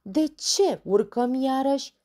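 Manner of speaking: loud, high puzzled question; astonished. Acted. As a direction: wonder aloud, baffled. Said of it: "se miră probabil". (mirare, tare și pe ton înalt)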